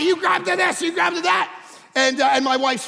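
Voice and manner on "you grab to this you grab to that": shouted orders. Retold or imitated. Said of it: "I'm telling everybody".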